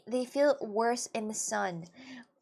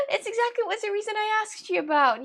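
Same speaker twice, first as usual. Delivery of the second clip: high exasperated; 10 semitones higher, 6 dB louder than usual. Real, not acted. Continuing exasperated rant.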